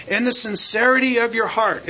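"in the sincerity of your heart" set apart and stressed; phrase emphasis. Shown as a demonstration. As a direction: give "sincerity" the weight of the phrase. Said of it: "with each one of those little phrases".

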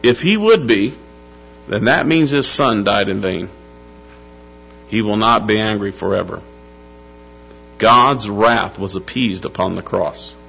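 ordinary talk, nothing marked

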